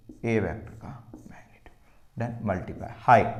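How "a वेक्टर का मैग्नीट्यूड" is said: whispered answer; hushed answer. Shown as a demonstration. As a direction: whisper it as a reminder.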